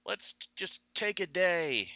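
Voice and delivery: a hint of exhausted hero praise; weary Tony Stark. Tony Stark thanking his team, lightly voiced weary praise. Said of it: "and then he says".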